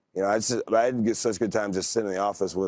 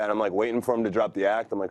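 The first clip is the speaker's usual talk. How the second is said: usual speaking voice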